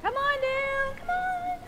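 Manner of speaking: beckoning singsong call. Real, not acted. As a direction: call them down on held high notes. Calling children up to the front.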